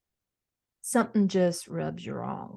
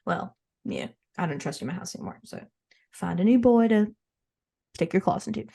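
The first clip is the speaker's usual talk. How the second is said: stern scolding. Pretend. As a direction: cut her off like a cold, stern mother. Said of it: "I'm gonna have to say".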